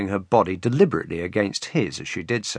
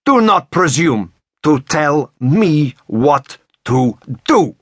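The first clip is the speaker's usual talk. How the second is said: put-on slow, punctuated Russian-accented shout; word-by-word shout; 5 semitones higher, 4 dB louder, slower than usual. A narrator's reading. Woman rebuking him between strokes, punctuated angry shout. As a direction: shout each word separately, high and slow. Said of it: "punctuated each shouted word".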